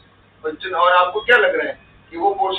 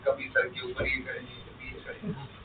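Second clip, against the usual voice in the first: trailing off into a soft, lower whisper about the antics; fading to a whisper; 6 semitones lower, 13 dB quieter than usual. Real, not acted. Teacher scolding the class about mischief, whispering. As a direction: let the voice sink to a whisper, disgusted.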